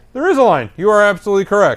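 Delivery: high-pitched, exaggerated concession; mock agreement. Accused passenger answering woman, mock agreement. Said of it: "I'm like".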